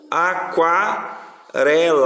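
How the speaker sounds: very slow, separated syllables. Shown as a demonstration. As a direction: spell it out by sound, unhurried. Teacher dictates word syllable by syllable. (sílaba por sílaba, bem devagar)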